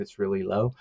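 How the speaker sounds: ordinary talk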